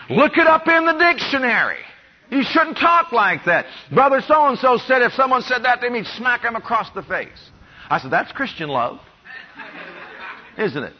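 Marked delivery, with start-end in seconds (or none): 0.1-7.5 much higher and louder, indignant; heated scold. Retold or imitated. The rebuking man lecturing the preacher, indignant and much louder. 8.1-10.9 softly chuckling through the irony; wry laughing reply. Retold or imitated. Preacher answering the scolding man, quietly chuckling and wry.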